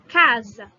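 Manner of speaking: "Casa" loud, "Ca" stressed. Shown as a demonstration. Teacher presenting new example loudly. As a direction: call out "Casa". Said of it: "a sílaba tônica?".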